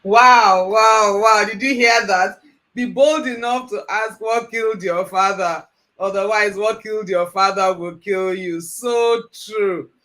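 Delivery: loud, laughing wow of real surprise; amused amazement. Real, not acted. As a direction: react loudly, tickled by how true it is. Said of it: "I'm just laughing here".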